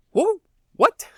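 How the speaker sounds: high, startled double take; mock shock. Townsperson hearing of a declaration of independence, mock startled. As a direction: act taken aback, voice jumping high.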